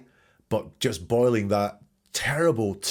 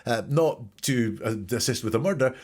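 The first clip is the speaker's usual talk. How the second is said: ordinary talk, nothing marked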